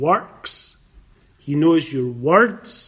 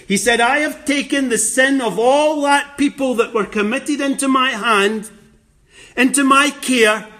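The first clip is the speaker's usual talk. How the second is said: raised, louder preaching voice as Christ; fervent preaching; 4 semitones higher, 4 dB louder than usual. Acted. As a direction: preach it with full conviction as Christ. Preacher voicing Christ, full force.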